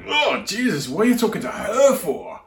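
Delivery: imitating appalled disciples, high and loud. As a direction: act out a loud, high-pitched protest. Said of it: "appalled by that".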